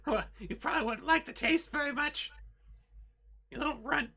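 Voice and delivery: very high, loud, squeaky rat voice; a tied-up were rat squeals at the guards, frantic and very high; squealing were rat. Acted. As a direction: squeal it like a panicked trapped rat. Were rat mocks the player.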